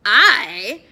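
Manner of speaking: loud, grandly emphatic; self-important. A narrator's reading. Addison drawing himself up before the guard, self-important. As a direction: puff it up with importance. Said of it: "drawing himself up".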